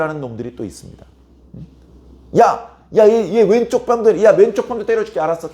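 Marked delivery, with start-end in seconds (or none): 2.4-5.5 high, loud taunting threat; acted bully. Cruel attacker offers to hit the left cheek too, acted bullying. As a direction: play the thug going for the other cheek. Cruel man goes on hitting.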